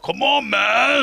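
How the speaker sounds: slow, high toy catchphrase; gruff tinny action figure. Pretend. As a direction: drag it out like a talking doll. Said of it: "I picture you saying something like that".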